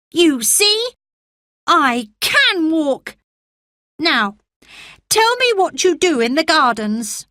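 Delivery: put-on a frail boy promising himself he'll live; sickly boy Colin.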